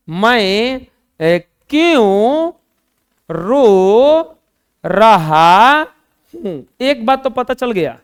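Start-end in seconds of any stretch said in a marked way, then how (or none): 1.7-6.6 क्यों drawn out and rising, then रो रहा हूं slowly; stress on क्यों. Shown as a demonstration. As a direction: lean on क्यों, word by word. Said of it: "अगर ऐसे आ जाए". (क्यों पर ज़ोर, धीरे-धीरे)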